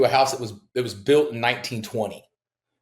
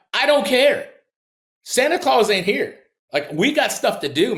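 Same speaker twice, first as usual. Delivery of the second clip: mock exasperated; 10 semitones higher than usual. Retelling brushing off his partner on Christmas, mock exasperated. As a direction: fire back as if she's wasting your time. Arguing on Christmas phone call.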